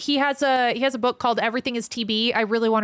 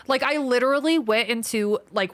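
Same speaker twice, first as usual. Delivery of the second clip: normal talking voice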